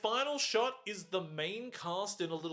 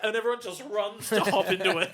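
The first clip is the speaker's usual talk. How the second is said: ordinary talk, nothing marked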